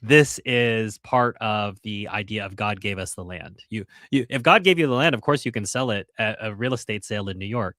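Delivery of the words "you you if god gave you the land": ordinary talk, nothing marked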